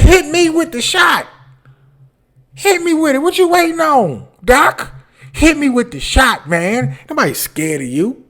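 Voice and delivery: high, loud cocky yelling at the doc; daring the doctor to hit him; mock bravado shout. Shouting at the doctor to bring the shot on.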